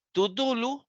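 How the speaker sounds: teacher modelling the Greek ending; Greek genitive read aloud. Shown as a demonstration. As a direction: sound out "Tudulu" syllable by syllable. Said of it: "se le agrega la preposición de".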